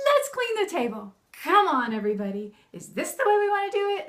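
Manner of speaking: high, loud mock-hysterical cheer; over-the-top fun mom, loud and high; playful rallying. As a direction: turn frustration into a big silly holler. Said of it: "I try and make it more fun".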